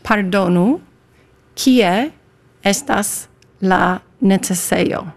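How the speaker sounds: "Pardonu, kie estas la necesejo" word by word, slowly; slow pronunciation demo. Shown as a demonstration. Guest teaching host "Pardonu, kie estas la necesejo", slow demo. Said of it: "Please, Amanda".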